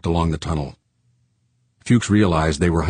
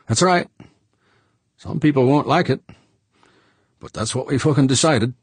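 higher, louder blunt miner; rough-edged George, raised voice; gruff George; 9 semitones higher, 3 dB louder than usual. A narrator's reading. George's voice held.

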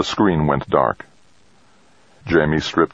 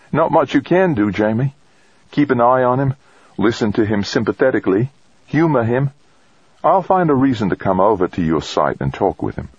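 higher woman's voice, advising; woman colleague; 7 semitones higher than usual. Acted. Woman advising colleague how to handle him, reassuring. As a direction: counsel him in a lifted woman's voice.

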